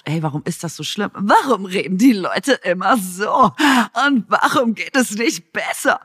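exasperated viewer. Retold or imitated. Mocking viewers' complaint about dubbing.

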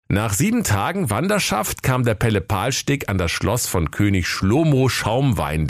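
ordinary delivery

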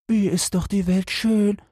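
very high, dreamy little creature voice; contented caterpillar. Acted. Caterpillar praises the world. (selige Kohlraupe, ganz hohe Stimme)

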